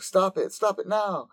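high, urgent yelling at the angel to stop; frantic call to halt the attack; shouting stop. Retold or imitated. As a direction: cry out to make her stop it now. Tomo yells at Icarus.

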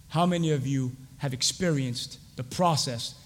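normal talking voice

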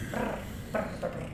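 whispered purring brr sounds; cat purr. Pretend. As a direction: purr softly like a kneading cat. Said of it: "I do little biscuits".

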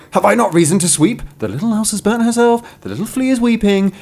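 high, hurried storybook protest; indignant little broom. Acted. Broom answers the passing cart, indignant high protest.

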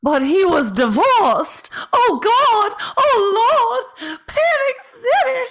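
high, hammed-up old-Hollywood transatlantic accent with a cry in the voice. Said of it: "I'm gonna attempt a transatlantic accent".

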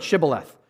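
"Shibboleth" said with an opening sh; correct shibboleth. Shown as a demonstration. Gileadites setting a test word, "Shibboleth" with a clear sh. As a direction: pronounce "Shibboleth" clearly, sh at the start. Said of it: "Pronounce this word".

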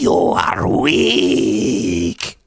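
very slow, low drawn-out menace; menacing cat voice. Retold or imitated. Cat sneering down at the man it beat, slow menace. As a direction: loom over your prey, deadly slow. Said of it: "cat was like".

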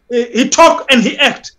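ordinary talk, nothing marked

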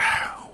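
soft, easy exhale; unbothered sigh; resigned sigh. Acted. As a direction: let out a quiet sigh of letting go. Retelling his shrug at delays.

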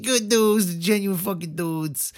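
high, slow, dopey stoner drawl; lazy stoned dude voice. Acted. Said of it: "Why are you squinting while you're saying that?".

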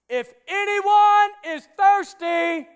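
slow, high, booming shout to a crowd; loud voice. Acted. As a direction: cry it out so everyone hears. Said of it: "in a loud voice".

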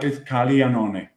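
"Caglianone" with gli as ly; corrected Italian pronunciation. Shown as a demonstration. Co-host insisting on the correction, Italian pronunciation. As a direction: say "Caglianone" Italian style, no hard G. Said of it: "No, no, it's".

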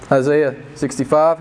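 ordinary delivery

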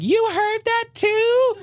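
high, slow falsetto; wife asks if the neighbors heard, high slow falsetto; squeaky wife voice. Pretend. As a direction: play the wife in a squeaky put-on voice. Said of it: "And she'll be like".

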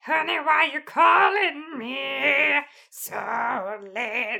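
slow, high rock-singer croon. Retold or imitated. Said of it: "This is my Hinder impression".

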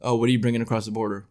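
fast, light border guard question; lightly voiced border guard; quick mock border guard. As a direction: rattle it off like a customs officer. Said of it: "you know, like".

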